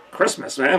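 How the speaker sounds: ordinary delivery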